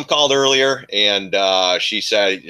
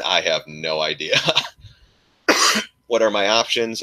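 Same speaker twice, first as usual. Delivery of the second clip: ordinary talk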